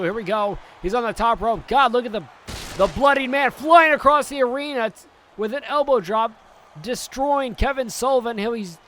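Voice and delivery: building to a loud, high climax; rising hype as the finisher comes; excited play-by-play. Acted. Live wrestling play-by-play.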